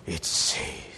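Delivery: ordinary delivery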